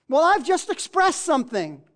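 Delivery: ordinary delivery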